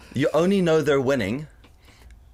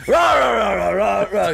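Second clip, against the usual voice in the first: higher, louder hammed-up rah; mock crowd cheer; 8 semitones higher, 6 dB louder than usual. MPs cheering the bill, mock loud rah. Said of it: "mock MPs cheering".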